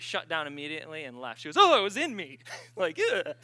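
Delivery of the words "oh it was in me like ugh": shocked realization. Retold or imitated. Sister realizing it was in her.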